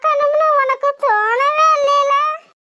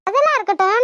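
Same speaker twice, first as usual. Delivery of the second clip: ordinary delivery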